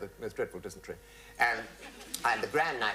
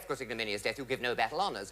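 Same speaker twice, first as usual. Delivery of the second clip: usual speaking voice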